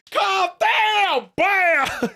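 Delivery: high, loud mock shout; mock Mad-Eye yell. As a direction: bark it out like a cartoon wizard. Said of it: "He's like".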